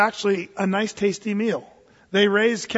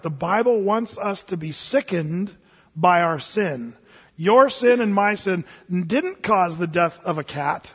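slow solemn emphasis; slower than usual. Real, not acted. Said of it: "preaching the point slowly".

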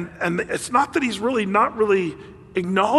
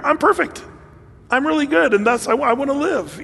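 mock whiny Hezekiah; 8 semitones higher than usual. Mocking Hezekiah's self-justifying plea.

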